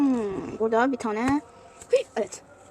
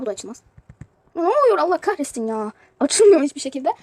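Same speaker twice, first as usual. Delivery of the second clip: normal talking voice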